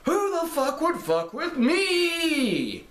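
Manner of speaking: high, drawn-out, cheesy hillbilly shout; badly delivered hillbilly villain line, hammed up high; hillbilly accent. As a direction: yell it like a cheesy hillbilly bad guy. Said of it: "he has his hillbilly accent during it".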